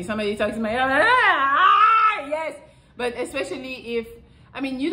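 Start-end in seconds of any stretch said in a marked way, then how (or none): 1.1-2.5 high, loud ecstatic "ah, ah, yes"; mock ecstatic moan. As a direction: ham up melting with pleasure. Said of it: "I go crazy".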